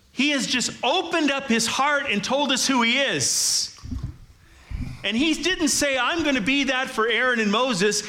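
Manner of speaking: high, loud, fervent sermon climax; full-force preaching. Real, not acted. Pastor proclaiming God's names at service's end, full-force preaching. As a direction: drive it home to the whole congregation, pitched high.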